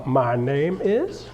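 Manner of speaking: drawn-out opening line; "My name is" said very slowly, rising on "is"; slow intro. Shown as a demonstration. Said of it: "Okay, uh".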